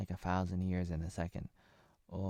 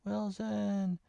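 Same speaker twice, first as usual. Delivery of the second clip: high calling yell of a name; Tom Hanks shouting Wilson; 11 semitones higher than usual. Retold or imitated. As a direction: call out Wilson like a castaway to his volleyball. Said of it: "Tom Hanks, do the thing".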